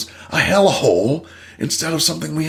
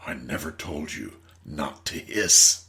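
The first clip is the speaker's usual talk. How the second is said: lower, slow wise reply; guru's answer; 10 semitones lower, 5 dB quieter, slower than usual. Acted. Guru correcting the snake, a touch of low calm wisdom. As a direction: deliver the punchline gently.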